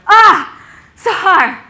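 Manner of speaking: high, loud exclamation; alarmed cry. Retold or imitated. Logan caught patting his friend's shoulder, alarmed cry.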